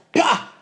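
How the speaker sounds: sudden high 'pah' of an axe strike; short, high-pitched chop noise; imitated axe blow. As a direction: voice the hit of an axe. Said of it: "cuando hay ese hachazo".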